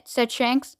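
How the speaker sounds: ordinary delivery, nothing marked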